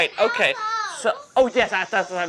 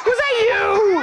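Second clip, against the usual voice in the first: mock accusing; 9 semitones higher, slower than usual. Mock accusing after fart noise.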